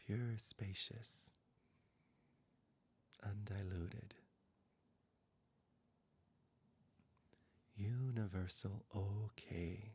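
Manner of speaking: slow, soft, whispered meditation voice; hushed closing meditation, words spaced out; guided meditation. Acted. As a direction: ease them into rest, barely above a whisper.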